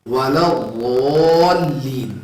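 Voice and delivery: وَلَا ضَالِّينَ recited slowly with a heavy ض; Quran recitation. Shown as a demonstration. Teacher reciting the Quran example for ض, slow. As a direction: chant it slow and full. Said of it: "اب اس کی مثال قرآن میں".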